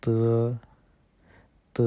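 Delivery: tongue on the teeth for त; त, the Chinese d sound. Shown as a demonstration. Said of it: "इसको हम बोलेंगे".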